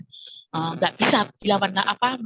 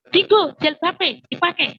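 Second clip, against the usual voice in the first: higher, louder mock scolding; scolding sibling; 7 semitones higher, 6 dB louder than usual. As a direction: imitate an older sibling's sharp order. Older sibling scolds child into wearing hijab.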